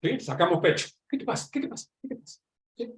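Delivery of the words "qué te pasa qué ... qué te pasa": quiet, higher-pitched mock challenge; half-voiced squaring up for a fight, trailing off; mock confrontation. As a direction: play at picking a fight under your breath. Acting out men squaring up.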